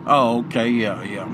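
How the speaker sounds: ordinary talk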